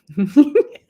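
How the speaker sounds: high, loud burst of real laughter. Real, not acted. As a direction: laugh out loud at the silly request. Laughing at son's trivial request.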